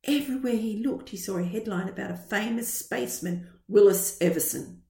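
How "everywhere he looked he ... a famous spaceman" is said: usual speaking voice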